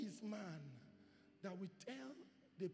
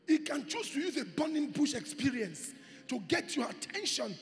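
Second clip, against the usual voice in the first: very loud, higher preacher shout; full-force sermon climax; preaching at full force; 7 semitones higher, 17 dB louder than usual. Acted. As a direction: thunder it to the congregation.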